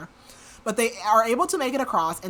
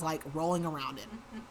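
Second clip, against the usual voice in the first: normal talking voice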